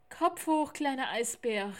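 loud, cheering call to a tired friend; encouraging call. A narrator's reading. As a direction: lift him up with your voice. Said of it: "rief ihm Theo aufmunternd zu". (aufmunternd gerufen)

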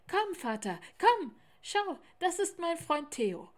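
high, loud, eager calling; excited child. A narrator's reading. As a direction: tug your father along. Child calls father excitedly. (aufgeregt rufendes Kind)

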